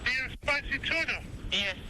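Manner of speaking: ordinary delivery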